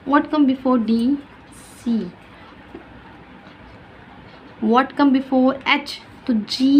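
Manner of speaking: What come before F said very slowly; slow teacher question. Shown as a demonstration. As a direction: draw out the question for a child. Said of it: "teacher quizzing child". (बच्चे से धीरे-धीरे पूछें)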